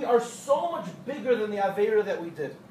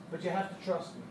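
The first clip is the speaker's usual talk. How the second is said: a hint of a quiet old man's voice, lower and softer; 5 semitones lower, 7 dB quieter than usual.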